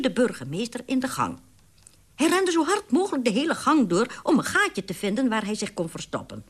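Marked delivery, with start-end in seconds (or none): none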